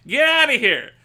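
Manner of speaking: loud, high get-out yell; shouting a chatter away; real yell. Real, not acted. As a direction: bark him out of chat. Yelling at chatter.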